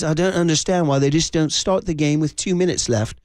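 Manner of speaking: imitated English accent, lightly put on; mock English accent, mildly exasperated. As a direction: quote an English dad in his accent. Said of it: "in his English accent".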